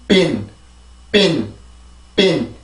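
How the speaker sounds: "bin" as a separate, falling model word; pronunciation drill. Shown as a demonstration. Teacher showing how "in" becomes "bin", pronunciation drill. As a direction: say "bin" with a voiced b, letting the pitch drop. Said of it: "이렇게 발음을 할 수가 있죠".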